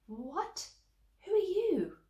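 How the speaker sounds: high, startled question. A narrator's reading. Cinderella startled by the sudden old lady, high surprised voice. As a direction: gasp it out in surprise.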